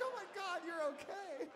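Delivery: very high-pitched imitated gushing; gushing relief. Friends hug the lost boy, high mock-gushing relief.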